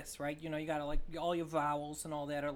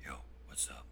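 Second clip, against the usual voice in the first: a much lower, softer, whispered deep voice; 10 dB quieter than usual. Shown as a demonstration. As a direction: drop the voice deep like a bass. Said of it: "my voice is so high, and then".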